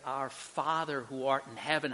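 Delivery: usual speaking voice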